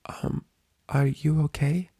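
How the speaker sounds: shy friend barely daring to ask; timid Fluttershy. A narrator's reading.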